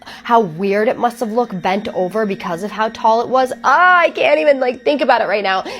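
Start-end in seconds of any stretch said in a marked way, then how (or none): 3.6-5.6 high, loud shuddering outburst; creeped out. Real, not acted. Host reacting to a scary tale, creeped out. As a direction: cry out as if shaking off a horrible image. Shudders at the story just told.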